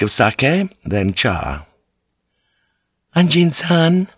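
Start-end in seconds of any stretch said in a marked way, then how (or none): 3.2-4.1 Japanese woman's high, questioning call; thin, high voice. A narrator's reading. Fujiko calls to Anjin-san, thin high voice. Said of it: "in her thin, high voice".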